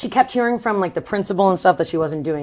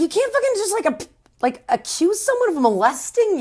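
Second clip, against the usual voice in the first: much higher, incredulous scolding; mock outrage; 12 semitones higher than usual. Parents confronting Fern over the lie, incredulous scolding. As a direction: scold her in disbelief.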